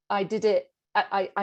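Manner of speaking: normal talking voice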